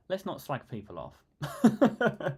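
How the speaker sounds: said slowly through laughter; laughing. Real, not acted. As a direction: laugh while pulling back the joke. Laughing at own comment.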